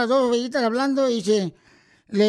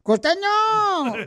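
high, long stretched call; 6 semitones higher, much slower than usual. Real, not acted. Host summoning costeño from Acapulco, calling out. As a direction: holler the name slowly, high.

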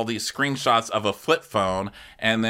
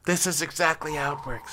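high, softer put-on voice; mock housewife; 7 semitones higher, 5 dB quieter than usual. Insisting to Andy how it works, high soft mimicked voice. Mocking housewife's grinning answer.

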